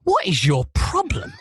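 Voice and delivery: high, slow, incredulous demand; exasperated challenge. Retold or imitated. Presenter confronting the seething footballer, high and incredulous.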